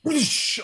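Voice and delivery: high rushing spill sound effect; vocal whoosh of a big spill. Retold or imitated. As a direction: imitate a drink splashing all over. Imitating the spilled drink.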